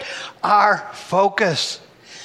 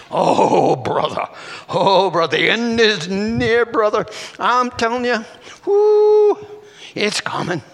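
mock doom-monger; 3 semitones higher, 3 dB louder than usual. Preacher mimicking people predicting the end is near, mock alarm. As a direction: lean hard on each 'Oh', mocking the alarm.

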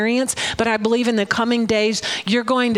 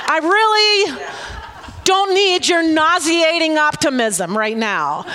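mock exasperation; 6 semitones higher than usual. Snapping back at a cheerful friend, laughing exasperation. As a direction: let the irritation burst out through a laugh.

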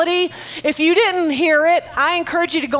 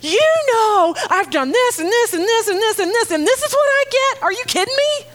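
much higher, exasperated protest to God; mock complaint to God; 8 semitones higher than usual. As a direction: climb in pitch to "are you kidding me". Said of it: "Has anybody in their lives ever gone".

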